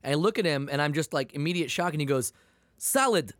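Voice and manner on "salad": short barked "Salad", pitched up; imitating the salad guy. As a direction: mimic a strange man's one-word demand. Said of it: "Why are you yelling salad at me?".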